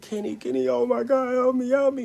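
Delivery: high, loud imitation of frantic cries; hysterical. Hurt friend calling Kenny for help, retold hysterical cries. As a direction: act out a panicked cry for help. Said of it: "She was so hysterical".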